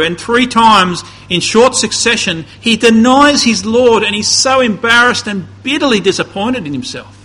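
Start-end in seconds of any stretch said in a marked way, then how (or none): none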